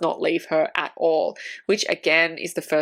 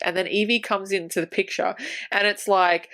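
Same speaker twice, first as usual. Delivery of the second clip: normal talking voice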